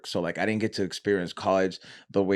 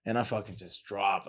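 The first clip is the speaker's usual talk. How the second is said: usual speaking voice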